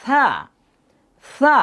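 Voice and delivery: "সা" as a light lisped th; thin pronunciation. Shown as a demonstration. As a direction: model "সা" for the class to repeat. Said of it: "একটু বলার চেষ্টা করেন".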